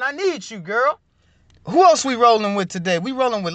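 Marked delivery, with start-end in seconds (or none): none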